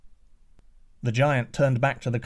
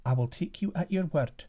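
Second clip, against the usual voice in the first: higher, quicker prince's voice; prince's reply; 5 semitones higher than usual. Acted. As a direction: answer boldly as the young prince. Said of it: "replied the prince".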